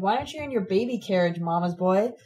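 ordinary delivery

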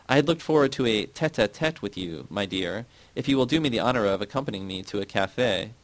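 ordinary delivery, nothing marked

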